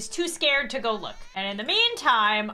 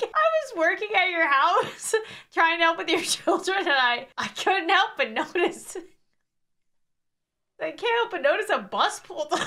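helpless real laughter breaking up the words; high, choked with laughter; crying laughing; 4 semitones higher than usual. Real, not acted. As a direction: barely get the quote out through laughs. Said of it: "I was actually crying laughing".

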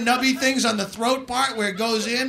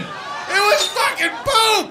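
laughing shout; 9 semitones higher, 4 dB louder, slower than usual. Real, not acted. Punchline reveal, laughing.

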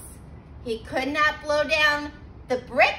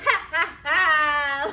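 wolf laugh; slower than usual. A narrator's reading. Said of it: "laughed the wolf".

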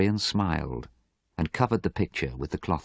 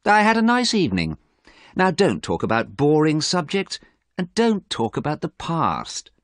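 higher, dismissive and bored; acted bored impatience; 10 semitones higher, 4 dB louder than usual. A narrator's reading. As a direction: brush the subject aside. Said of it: "Dorian sounded very bored".

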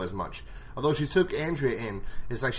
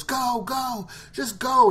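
high, urgent command; urgent shout; 13 semitones higher, 5 dB louder, much slower than usual. Retold or imitated. T-Dog yelling at Carol to run, high and urgent. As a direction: push her away to save her. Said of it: "He goes".

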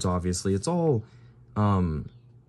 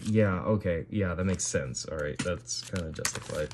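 ordinary talk, nothing marked

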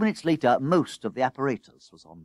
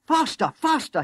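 high, frantic encouragement; urgent high-pitched chivvying; urging on; 13 semitones higher, 3 dB louder than usual. A narrator's reading. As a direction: spur the crew on. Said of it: "he encouraged them".

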